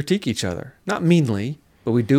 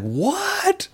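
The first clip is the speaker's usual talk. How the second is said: high, startled squeak; a sudden jump up in pitch of disbelief; surprised what. Retold or imitated. Recalling his shock at the Atlanta office, startled. Reacting to finding CMI office.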